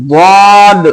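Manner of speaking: "वाद" heavy, with a long rounded aa; thick वाद. Shown as a demonstration. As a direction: draw out "वाद" with rounded mouth. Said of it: "मोटा करके बोलना है". (मोटा, लंबा "वाद")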